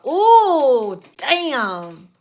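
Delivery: surprised ooh. Real, not acted. Reacting to card.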